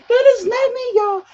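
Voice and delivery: loud, very high squealed denial; playful protest. Real, not acted. Host disowning her star joke to viewers, high playful squeal. Joking denial after laughing about being star.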